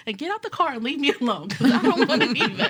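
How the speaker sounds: laughing mock outburst.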